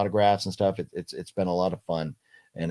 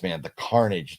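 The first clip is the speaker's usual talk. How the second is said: ordinary talk, nothing marked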